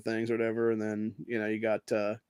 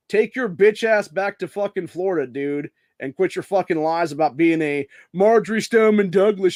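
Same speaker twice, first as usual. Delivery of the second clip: heated telling-off, louder and higher; angry retort; 5 semitones higher, 6 dB louder than usual. Pretend. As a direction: lay into an imagined liar at full rant. Imagined angry retort to student.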